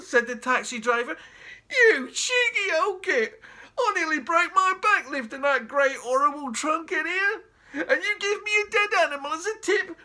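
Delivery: high, loud indignant taxi driver; outraged cabbie. Acted. Taxi driver outraged at being tipped a dead ferret. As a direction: blow up at the stingy old man.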